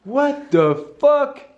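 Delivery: slow, high astonished exclamation; drawn-out disbelief; stunned. Real, not acted. Reaction to the splits. (stupéfaction lente)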